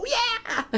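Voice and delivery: high wavering squeal, imitating an over-excited dog; excitable dog noise. Guide dog owner showing how her dog reacts, high excited squeal. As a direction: squeal like a dog that can't stay calm.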